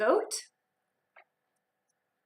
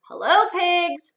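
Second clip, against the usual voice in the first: higher, louder slow hello; cheerful duck greeting; 7 semitones higher, 7 dB louder, much slower than usual. Acted. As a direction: greet the pigs brightly.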